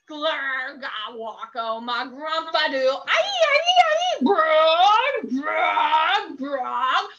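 loud gargling nonsense sounds; while gargling. Acted. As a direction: gargle and shout the silly words. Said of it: "make silly sounds like".

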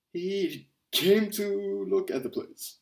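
high, loud, slow accusing workman; workman's voice. A narrator's reading. Older workman telling the caretakers, high slow loud voice. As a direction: drag it out loudly, pointing at him.

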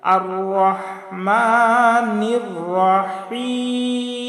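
slow chant, long vowels in "রহমানির রহিম"; careful Quran recitation. Shown as a demonstration. Teacher modelling the verse for learners, slow careful recitation. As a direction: press "হ" from mid-throat, hold the long vowels. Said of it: "চাপ দিয়ে উচ্চারণ করে পড়তে হবে".

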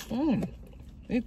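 chewing while talking; speaking through a mouthful; mouth full. Real, not acted. Seen 0: Bit the dumpling at -2.0; lips pursed, chewing while speaking.

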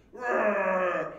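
long, rumbling imitation roar; loud roar. Shown as a demonstration. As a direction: roar it out like thunder. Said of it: "a voice roars".

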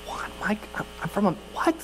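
breathy stammering confusion; stuttering. Retold or imitated. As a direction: stammer it out, caught off guard. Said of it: "as I'm just stuttering".

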